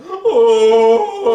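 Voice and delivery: long, loud mock wail; imitated wailing. Reviewer mimicking Wallace in the walrus suit, high mock wail. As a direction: cry out high and drawn-out, mimicking him.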